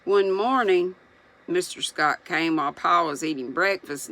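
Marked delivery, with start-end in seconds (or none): none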